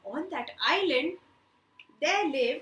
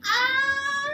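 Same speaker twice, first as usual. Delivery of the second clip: high loud scream of a man at wits' end; exasperated scream; 13 semitones higher, 9 dB louder than usual. Acted. Poor man tormented by noise, screaming out. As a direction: let out a frantic cry.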